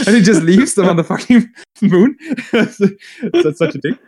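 high, amused telling broken by laughter; laughing while talking. Real, not acted. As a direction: tell it through helpless laughter. Amused at Guilliman abandoning the allies.

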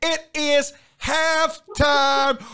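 drawn-out announcement. Real, not acted. Host calls halftime to his listeners, drawn-out announcement. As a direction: stretch each word out long.

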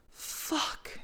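whispered, almost no voice; breathy whisper. Acted. Eva whispering her thought, overwhelmed by desire. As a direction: breathe the word out. Eva's aroused inner thought.

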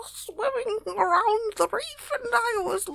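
high, gurgling underwater voice; talking underwater. Acted. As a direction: speak like your mouth is full of water. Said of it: "I was trying to talk underwater".

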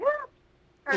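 high yelping little howl; wolf yip. Shown as a demonstration. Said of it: "my wolf howl is more like a, like a yip".

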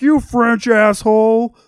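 mock insult. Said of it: "off-screen line where he's like".

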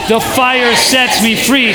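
drawn-out crowd-leading call; really loud. Shown as a demonstration. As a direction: lift the pitch and project every word. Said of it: "Say it one more time really loud".

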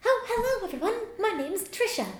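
high squeaky fairy voice; chirpy tiny fairy introducing herself. Acted. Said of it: "She just flew in here".

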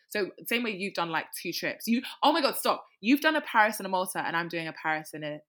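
normal talking voice

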